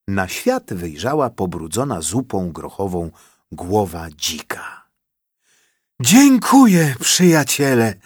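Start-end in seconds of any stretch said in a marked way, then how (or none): 6.0-7.9 high, loud breathless thanks; panting happy boar. A narrator's reading. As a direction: puff out thanks with joy. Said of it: "wysapał uradowany dzik".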